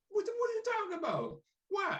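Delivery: imitating Ali's shocked reaction; incredulous. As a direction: squeak out the disbelief.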